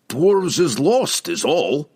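slow, high-pitched dwarf voice; defensive dwarf, drawn out. A narrator's reading. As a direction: play a put-upon old dwarf slowly. Dwarf king's defensive character voice.

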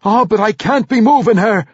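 high-pitched, urgent dwarf healer; protesting. A narrator's reading. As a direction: protest the order loudly. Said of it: "Mandarina protested".

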